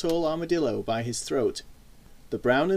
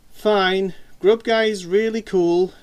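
higher, louder, drawn-out fake agreement; sarcastic; 5 semitones higher, 6 dB louder, much slower than usual. A narrator's reading. Sister giving in to her brother's threat, sarcastic. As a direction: concede it slowly, dripping with sarcasm. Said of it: "she admitted sarcastically".

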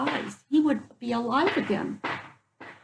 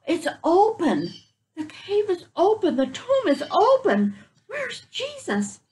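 high, rising alarm, slowing on the last question; astonished women; 9 semitones higher than usual. Acted. Women at the tomb calling out in high surprise. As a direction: gasp it out as if the tomb stands empty.

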